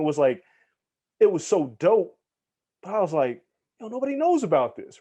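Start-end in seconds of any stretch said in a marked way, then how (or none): 3.8-5.0 excited. Retold or imitated. Excited retold thought about album.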